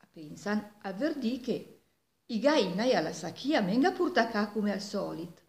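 ordinary delivery

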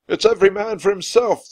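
high, loud order to everyone; captain's shout. A narrator's reading. Captain to all of his crew, shouting. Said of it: "the captain shouted".

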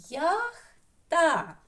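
ЯХТА in two separated beats, ЯХ and ТА; high, loud syllable-by-syllable ЯХТА; split into syllables. Shown as a demonstration. Teacher shows two syllable rooms, ЯХТА split in two. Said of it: "двохскладовий будиночок".

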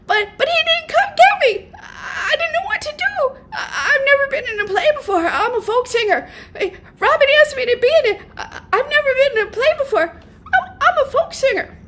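very high, stammering, tearful babble; panicked jabbering whisper. A narrator's reading. Rookie pleading with Paul Newman backstage, panicked high jabbering. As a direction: jabber excuses in a squeaky, frantic stage whisper. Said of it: "I stopped jabbering".

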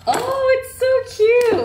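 high, slow gushing squeal; squealing delight. Real, not acted. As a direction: gush over something adorable. Delighted at a cute item.

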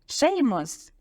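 teacher summoning a pupil; calling out. A narrator's reading. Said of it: "гукнув професор Люпин".

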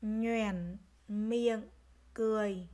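"Nhoẻn miệng cười" word by word, full dipping tone on "Nhoẻn"; careful word reading. Shown as a demonstration. Teacher modelling "Nhoẻn miệng cười" for pupils, separated careful syllables. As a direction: sound out "Nhoẻn" fully, then "miệng", then "cười". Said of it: "các em lưu ý tiếng nhoẻn".

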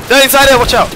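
high loud urgent warning; shouted warning. Real, not acted. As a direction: warn your teammate, loud. Warning teammate under fire.

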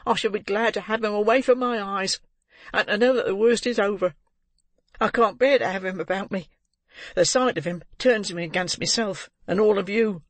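put-on high-pitched, agitated young woman; bitter girl. A narrator's reading. Girl who can't bear the boy about her, bitter. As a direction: pour it out high and vehement.

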